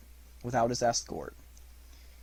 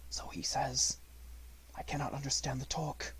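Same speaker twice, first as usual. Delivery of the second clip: quiet; 9 dB quieter, faster than usual. Acted. Mowgli speaking quietly.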